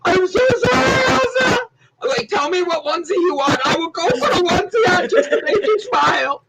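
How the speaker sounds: high, wailing, laughing apology; hammed-up apology. Host begging forgiveness from the teary co-host, hammed-up wailing. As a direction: overdo the sorry to cheer her up. Comic over-the-top apology.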